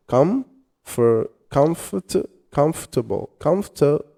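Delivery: "comfortable" broken into syllables; sounding out words. Shown as a demonstration. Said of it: "So as you can see here".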